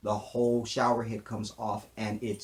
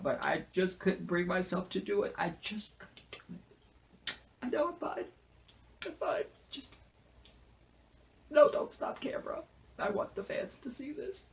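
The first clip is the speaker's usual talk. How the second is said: very high, choked mock crying; mock breakdown; 9 semitones higher, 5 dB quieter than usual. Reviewer telling fans he's fine, mock tearful breakdown. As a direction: play it overcome, voice squeaking away into near silence.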